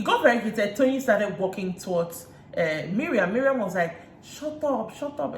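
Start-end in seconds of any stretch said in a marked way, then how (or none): 4.2-5.4 high-pitched retold quarrel retort, not shouted; mock indignant. Recapper mimics Miriam in a heated quarrel, mock indignant.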